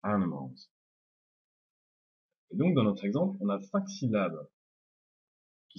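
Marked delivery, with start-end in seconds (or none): 0.0-0.6 "animals" said in English with reduced second and third vowels; English pronunciation. Shown as a demonstration. Teacher giving the English word, "animals" with weak neutral I and A. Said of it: "se prononce E aussi".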